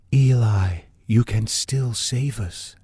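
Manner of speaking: slow, eerie whispered plea; slow pleading whisper. Acted. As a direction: breathe it out like a ghost. Mysterious woman on the radio.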